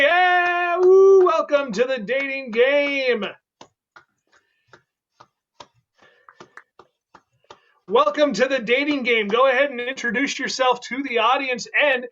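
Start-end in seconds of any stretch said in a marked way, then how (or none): 0.0-9.3 game show host. Acted. Host welcomes contestant.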